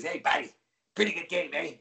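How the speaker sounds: mock New York buddy-to-buddy greeting; New York accent. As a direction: put on a big fan's New York accent. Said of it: "in his New York accent".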